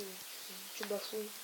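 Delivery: ordinary delivery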